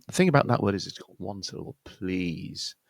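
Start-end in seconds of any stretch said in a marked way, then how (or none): none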